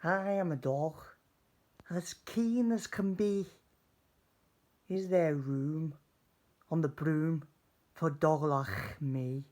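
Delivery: high, loud, keen dog voice; an eager pup begging to join. Acted. Dog asking the witch for room, eager and loud. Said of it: "then eagerly said".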